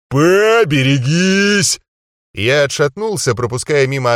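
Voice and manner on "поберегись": slow, loud, stretched-out call; drawn-out warning shout. Acted. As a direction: bellow the warning slowly. Hurling an attacker overhead.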